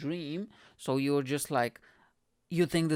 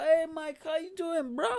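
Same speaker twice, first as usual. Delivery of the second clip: high, breezy greeting from buddies; friendly mates; 14 semitones higher than usual. Retold or imitated. Comedian mates hugging him in jail, high and cheery.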